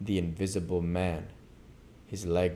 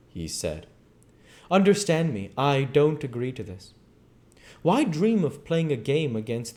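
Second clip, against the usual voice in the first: loud, urgent pleading to cover noise; loud and fast; 9 semitones higher, 6 dB louder than usual. Acted. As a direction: talk him down loudly to mask the sound. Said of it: "began to speak loud and fast".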